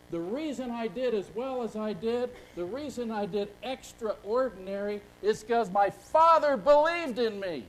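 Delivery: building to a loud, slowed finish; emphatic quote. Retold or imitated. Quoting Jim Valvano's words.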